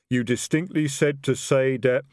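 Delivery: a troll fumbling for words in his suit. A narrator's reading.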